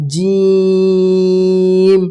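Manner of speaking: জিম stretched very long and slow; drawn-out jim. Shown as a demonstration. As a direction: hold the জিম long and forceful. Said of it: "জিম অক্ষরটিকে আমাদেরকে শক্তি দিতে হবে". (জিম লম্বা টেনে, শক্তি দিয়ে)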